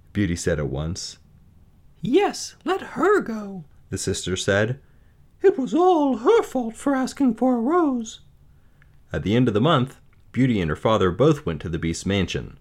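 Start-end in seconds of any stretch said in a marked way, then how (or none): 2.0-3.7 very high, drawn-out character voice; high-voiced sister sending her sibling off; spiteful sister. A narrator's reading. 5.4-8.2 very high, spiteful whine; high-pitched sister, full of blame; accusing sister. A narrator's reading.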